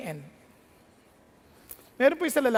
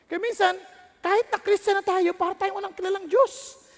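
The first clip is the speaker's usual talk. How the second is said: rising, louder preaching that peaks in a shout; preaching at full force; 8 semitones higher, 5 dB louder than usual. Acted. Pastor warning the congregation, building to a shouted climax. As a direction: build the sermon up to a cry.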